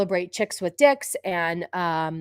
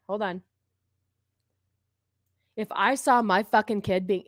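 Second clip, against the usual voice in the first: ordinary talk, nothing marked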